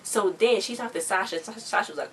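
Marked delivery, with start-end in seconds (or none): none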